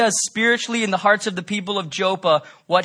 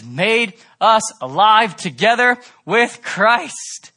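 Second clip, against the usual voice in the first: loud, rising pulpit crescendo; preacher thundering grace over the congregation; full-force preaching; 3 semitones higher, 6 dB louder than usual. Acted. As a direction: build the scripture to a shout.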